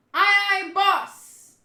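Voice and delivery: loud, high call; eager acted shout; crew's shouted reply. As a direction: holler back to the boss. Crew answering the boss.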